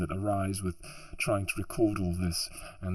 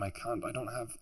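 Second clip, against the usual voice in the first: ordinary talk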